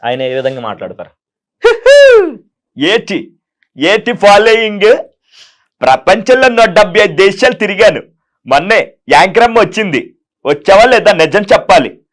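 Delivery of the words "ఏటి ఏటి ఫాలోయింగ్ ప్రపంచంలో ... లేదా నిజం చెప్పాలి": high, loud self-praising preacher voice; a showy, loud public-figure monologue; KA Paul impression. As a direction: boast grandly about your global following. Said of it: "KA Paul గారిది చేస్తారా?".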